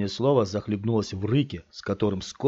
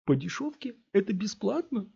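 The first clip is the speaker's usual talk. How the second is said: much higher, mocking yelp; jeering Tony; 12 semitones higher than usual. A narrator's reading. Tony mocks the deal, jeering. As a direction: jeer with fake surprise. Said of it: "сказал Тони".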